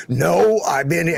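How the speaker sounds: uncle's reply. Retold or imitated. Uncle answers Greg's joke.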